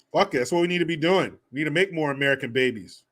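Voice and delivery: normal talking voice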